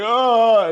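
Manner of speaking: loud, high, drawn-out "oh"; hammed-up moan of release; mock climax moan. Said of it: "then he's like".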